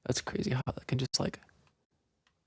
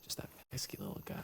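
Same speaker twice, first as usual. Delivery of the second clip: whispering; 14 dB quieter, faster than usual. Real, not acted. Sneaking past enemy.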